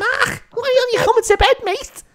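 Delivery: very high Scouse nagging screech; hammed-up henpecking in broad Scouse; whiny Scouse screaming. Co-host nagging Mark to come to bed, whiny screamed Scouse. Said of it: "Two whiny voices".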